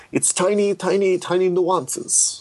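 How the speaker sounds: lightly mocking French-accented director. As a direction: put on a fond, exaggerated French accent. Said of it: "in his very French accent".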